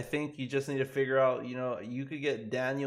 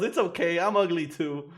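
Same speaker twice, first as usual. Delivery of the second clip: a touch higher, louder joking reassurance; mock Daniel; 5 semitones higher, 5 dB louder than usual. Streamer consoling the viewer, lightly voiced joking. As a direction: ease off the roast playfully.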